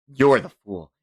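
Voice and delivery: plain reading voice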